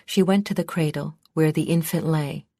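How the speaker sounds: ordinary delivery, nothing marked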